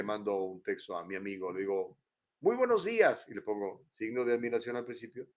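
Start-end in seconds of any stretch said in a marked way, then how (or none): 2.4-3.2 saludo exclamativo, agudo y fuerte; saludo efusivo. Acted. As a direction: saluda con signos de admiración en la voz. Dicta su saludo con exclamación. (efusivo, en tono alto)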